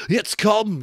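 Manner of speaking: high, loud frightened gasp; terrified gasp. A narrator's reading. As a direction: gasp it out in panic. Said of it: "gasped Piggy".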